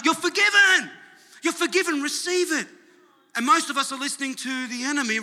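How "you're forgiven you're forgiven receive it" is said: high, ringing shout of proclamation; crying out. Acted. Blood of Jesus telling the guilty they're forgiven, crying out. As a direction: shout it out to everyone, high and impassioned. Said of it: "blood of Jesus is crying out".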